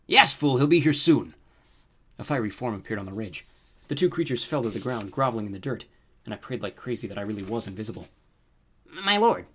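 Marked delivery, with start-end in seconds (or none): 0.1-1.3 higher, louder scolding monster; chiding rooster creature. A narrator's reading. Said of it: "the rooster-footed guy chided". 8.9-9.5 much higher, louder servile toad voice; groveling toad. A narrator's reading. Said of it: "the toad said".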